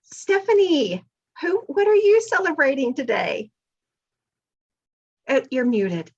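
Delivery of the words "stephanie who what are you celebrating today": high, loud, stretched-out name then question; excited greeting. Real, not acted. Host calling on Stephanie to share her celebration, excited. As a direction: call on her brightly, pitched way up. Host calls on next guest.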